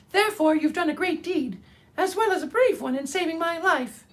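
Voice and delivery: high, tiny royal squeak; a little mouse proudly declaring her rank; proud mouse queen. Acted. Mouse queen voiced in story.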